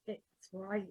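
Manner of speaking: low, softened murmur; whispered aside. Acted. Player's character confides in Jackson, hushed aside. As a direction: slip it in under your breath. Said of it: "I just whisper to Jackson".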